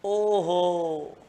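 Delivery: very drawn-out exclamation; stupore dimostrativo. Shown as a demonstration. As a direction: stretch an oh-ho of wonder as an example. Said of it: "जैसे".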